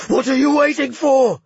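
loud high roar. A narrator's reading. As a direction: bellow it in fury. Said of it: "Magnus roared".

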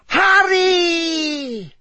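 piercing high, drawn-out cry; high cold voice. A narrator's reading. Cold creature calling out from the robes, high drawn-out shriek. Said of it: "Harry heard the high, cold voice again".

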